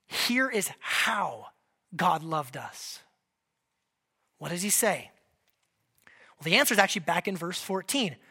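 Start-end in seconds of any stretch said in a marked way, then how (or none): 0.0-3.0 softer, slow, with a pause after "how"; hushed and deliberate, a pause between "how" and "God"; slow emphatic gloss. Shown as a demonstration. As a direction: let "Here is how" hang, then land "God loved us" lower. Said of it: "in this way".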